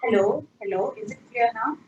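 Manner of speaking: ordinary talk, nothing marked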